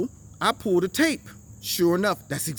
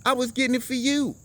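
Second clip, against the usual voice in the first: put-on innocent kid excuse; 7 semitones higher than usual. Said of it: "with a straight face and says".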